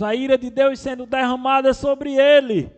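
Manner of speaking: loud, high proclamation; full-force preaching. Real, not acted. Preacher showing God's wrath poured on Christ, full-force preaching. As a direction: thunder it out to the congregation.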